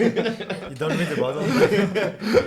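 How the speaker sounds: laughing. Real, not acted. Teasing host over misread sponsor.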